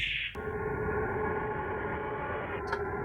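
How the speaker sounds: a quiet, breathy burst of laughter. Real, not acted. Player laughing at Robotnik's taunt, quiet breathy laugh. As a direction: laugh under your breath at the game.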